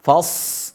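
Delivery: "Fas" with open a and long hissed s; flowing sad. Shown as a demonstration. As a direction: let the s of "Fas" run on.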